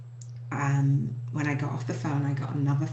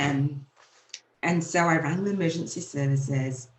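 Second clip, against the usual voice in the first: ordinary delivery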